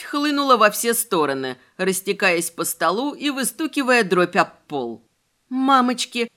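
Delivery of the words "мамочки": small frightened squeak; squeak of fright. A narrator's reading. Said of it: "пискнула я".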